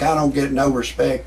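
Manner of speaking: ordinary talk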